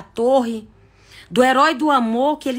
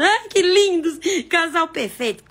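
mock gushing fan, higher pitch; gushing romanticizer; 4 semitones higher than usual. Public swooning over the celebrity couple, mock gushing. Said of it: "igual o povo romantizando".

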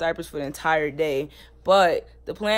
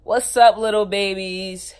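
excited greeting; 3 semitones higher, 9 dB louder than usual. Acted. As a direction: greet the viewers like a big show opener. Vlog intro greeting, loud.

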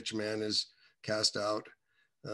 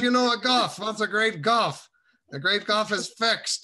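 Mister Rabb insisting; 9 semitones higher, 6 dB louder than usual. Retold or imitated. Old man insisting to a puzzled young reader, high and loud. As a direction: drive it home like an old preacher losing patience. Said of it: "He goes".

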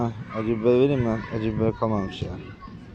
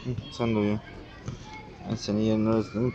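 ordinary talk, nothing marked

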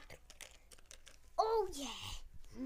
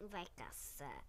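much lower, quiet growl; deep monster voice; 11 semitones lower, 9 dB quieter, slower than usual. Pretend. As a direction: drop into a big beast voice. Repeated in monster voice.

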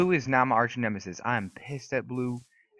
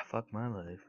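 slow, softer, deflated groaning; resigned sigh; 3 semitones lower, 10 dB quieter than usual. Real, not acted. Gamer after a setback, resigned sigh. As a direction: sigh heavily, then let it drop slowly.

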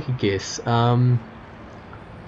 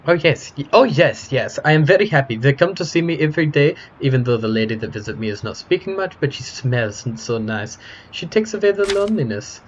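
put-on eager, heavily foreign-accented chatterbox; happy foreign-accented character; 4 semitones higher, 3 dB louder than usual. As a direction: gush politely in a thick foreign accent. Voices a game character.

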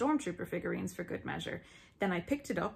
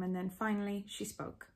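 ordinary talk, nothing marked

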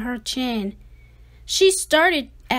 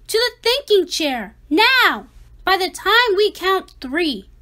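louder and higher command; stern order; 6 semitones higher, 6 dB louder than usual. Acted. Parents ordering Ada to the thinking chair, a stern raised command. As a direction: send her off firmly.

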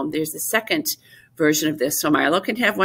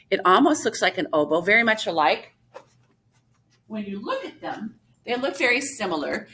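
normal talking voice

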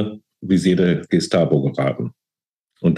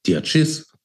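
a higher, sneering cop's aside; lightly voiced policeman; 10 semitones higher than usual. A narrator's reading. Policeman mocks the nervous woman to his colleague, scornful. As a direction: let the officer scoff about her. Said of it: "fragte Kunze seinen Kollegen".

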